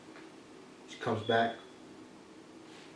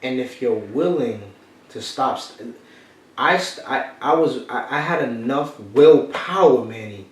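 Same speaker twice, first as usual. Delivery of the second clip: usual speaking voice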